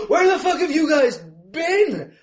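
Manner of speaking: high, loud, drawn-out shout; angry yell. Acted. Van passenger confronting the crew over stabbed Steve, angry yelling. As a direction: holler it slowly at the whole crew, outraged.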